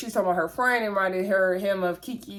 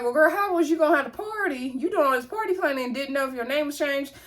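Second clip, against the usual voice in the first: high-pitched, disbelieving challenge; playful telling-off, pitched well up; mock incredulous scolding; 7 semitones higher than usual. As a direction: call her out in a raised pitch. Host mock-scolds cast member's party planning.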